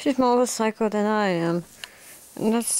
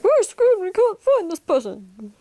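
mock agents; 11 semitones higher, 5 dB louder than usual. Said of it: "then they're like".

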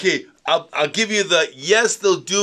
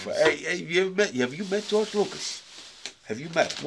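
George Lucas impersonation; 4 semitones higher, 4 dB quieter than usual. Retold or imitated. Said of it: "your horrific George Lucas impersonation".

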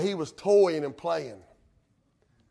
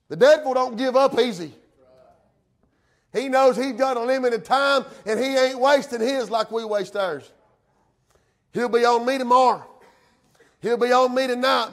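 raised, insistent pulpit voice; impassioned preaching; 8 semitones higher, 9 dB louder than usual. Real, not acted. Preacher warning the devil don't give up, impassioned preaching. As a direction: press the warning on the congregation.

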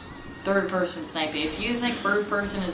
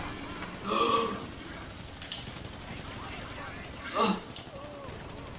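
sharp breathy gasp; 6 semitones higher, 10 dB quieter than usual. Real, not acted. Shocked during gunfight.

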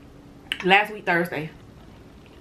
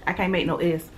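usual speaking voice

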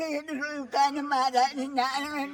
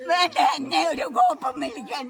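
angry shout; 6 dB louder than usual. Real, not acted. Upset old woman refusing comfort.

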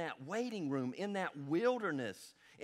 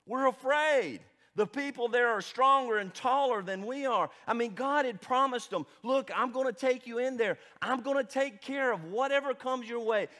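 louder, higher preaching voice, driving each line; full-force preaching; 6 semitones higher, 7 dB louder than usual. Acted. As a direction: preach it out to the congregation. Pastor preaching about fear.

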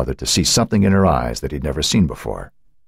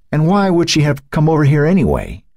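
high, hurt protest; wounded teenager's raised-pitch retort; pained, defensive; 10 semitones higher, 3 dB louder than usual. A narrator's reading. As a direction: push back while hiding the hurt. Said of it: "struggled not to let his voice reveal the pain".

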